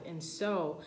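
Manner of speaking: ordinary talk, nothing marked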